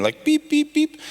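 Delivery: high, playful beep beep beep; mock payment beep. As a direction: chirp it like a card reader going off. Imitating a card payment terminal.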